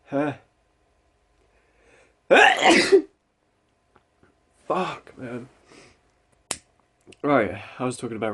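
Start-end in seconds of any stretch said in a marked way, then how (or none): 2.3-3.0 loud real sneeze; sudden violent sneeze; sneezing. Real, not acted. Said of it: "Oh, it's coming".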